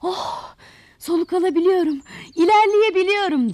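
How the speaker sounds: high, loud cry of relief; joyful shouting. Acted. Freshly hoed roots able to breathe, shouting with joy. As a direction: sigh "oh", then burst into delighted shouting. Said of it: "sevinçle bağırıyor".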